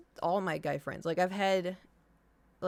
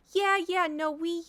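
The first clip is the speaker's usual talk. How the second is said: high, loud eager yeah; quick eager reassurance to the tech; eager jump in; 10 semitones higher, 5 dB louder than usual. Retold or imitated. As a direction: jump in eagerly to correct him. Eagerly answering new vet tech.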